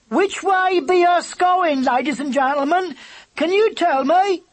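high, loud call over the wind. Acted. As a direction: call out to the passing flock. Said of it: "she cried".

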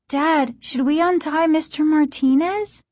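put-on high, questioning child's voice; Margaret's question. A narrator's reading. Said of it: "Margaret asked".

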